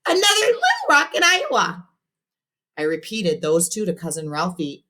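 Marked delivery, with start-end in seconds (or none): 0.1-1.9 straining whine. A narrator's reading. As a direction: squeeze the words out. Robert straining for answers.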